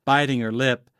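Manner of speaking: plain reading voice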